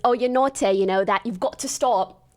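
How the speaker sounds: mock British accent. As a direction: imitate a flirty Brit in UK accent. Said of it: "He's U K".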